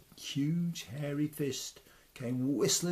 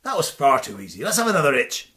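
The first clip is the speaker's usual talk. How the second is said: loud, fast, mocking challenge; swaggering loud taunt; cocky taunt; 11 dB louder, much faster than usual. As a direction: goad the fallen giant. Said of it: "says Tom".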